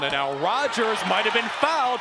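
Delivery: ordinary talk, nothing marked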